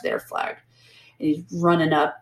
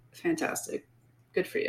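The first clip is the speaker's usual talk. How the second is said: breathy whispered sarcasm; sarcastic whisper; 10 semitones higher, 13 dB quieter than usual. Real, not acted. Mocking the vain hero to her co-host, whispered sarcasm. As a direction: whisper it, mocking the hero's vanity.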